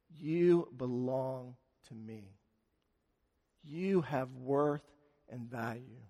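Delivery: quiet, loving comfort; tender reassurance. Acted. Jesus reassures the healed woman.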